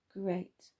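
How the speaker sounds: ordinary delivery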